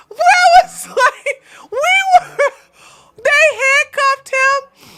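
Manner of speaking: high, loud, squeaky from laughing; real helpless laughter in the voice; talking through laughter. Real, not acted. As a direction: keep telling it while cracking up. Telling it while cracking up.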